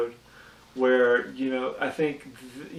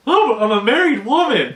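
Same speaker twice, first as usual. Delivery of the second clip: high, loud mock-flustered wife; Marge, flustered; 9 semitones higher, 7 dB louder than usual. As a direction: gasp it out like a shocked housewife. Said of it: "And she's like".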